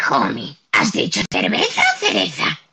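lightly voiced Castilian Marge, reproachful; reproachful, cheerful Marge in the Spain dub; Marge Spanish dub. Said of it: "en castellano suele ser como mucho más reprochable y alegre".

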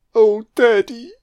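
high, loud put-on kid voice; mock child. Viewer quoting a child's line to Daddy, mock child voice. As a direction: call out like a child to a parent.

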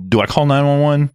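loud, high panicked yell; retold panic. Asking his partner whether to call for help, retold loud panic. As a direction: blurt it out loud, acting out panic. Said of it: "so I'm freaking out".

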